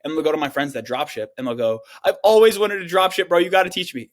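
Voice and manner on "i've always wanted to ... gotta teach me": high, loud gushing; hammed-up eager plea, high and loud; mock eager beggar. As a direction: gush like a wannabe begging to learn. Mocking eager wannabe to friend.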